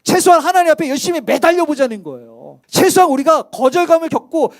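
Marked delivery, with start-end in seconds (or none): none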